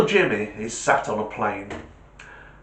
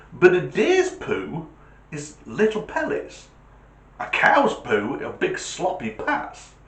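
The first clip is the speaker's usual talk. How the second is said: put-on know-it-all kid; 6 semitones higher, 3 dB louder than usual. [name] quizzing stranger.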